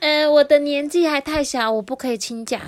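put-on high, loud small-child voice; put-on squeamish child voice; little sister. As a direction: refuse like a little girl.

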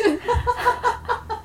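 high laughing squeal; high giggle. Real, not acted. 笑自己舍不得贴. (高声咯咯笑)

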